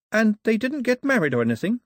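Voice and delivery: put-on a girl appalled at the story; shocked. A narrator's reading. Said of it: "looking truly shocked".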